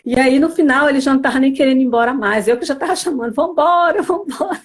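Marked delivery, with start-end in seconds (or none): 3.3-4.6 higher, light hurrying call; calling to leave, retold. Wife calling her husband to go home, hurrying. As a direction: playfully imitate your own nagging to go.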